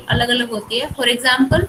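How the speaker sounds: ordinary talk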